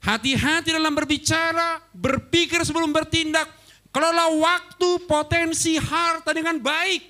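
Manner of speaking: high, loud, slowing preacher's call; full-force preaching. Real, not acted. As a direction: proclaim it to the whole hall.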